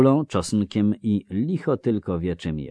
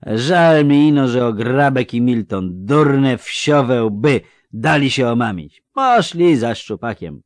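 loud, higher, slurring tipsy villager; drunk Melfi; 7 semitones higher, 12 dB louder than usual. Acted. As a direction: call out like a cheery drunk. (pijany Melfi, głośno i bełkotliwie)

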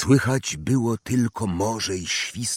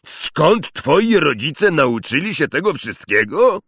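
loud, indignant booming voice; outraged on the boy's behalf; indignant giant; 6 semitones higher, 4 dB louder than usual. Acted. Hagrid indignant.

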